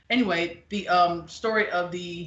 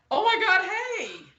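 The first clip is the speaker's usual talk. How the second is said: much higher, squealy "oh my God, hey"; mock gushing greeting; 14 semitones higher than usual. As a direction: gush like spotting a new friend, hammed up. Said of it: "that'll be like".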